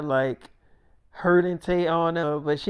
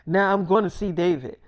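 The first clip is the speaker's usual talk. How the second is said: ordinary talk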